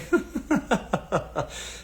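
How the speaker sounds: long breathy run of chuckles; amused laugh. Real, not acted. Host laughing at his own layaway example, amused chuckle.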